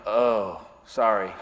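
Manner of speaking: low, soft dejected apology; deflated, quiet loser voice; loser mode. Acted. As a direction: shrink into a meek loser. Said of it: "I instantly go into loser mode".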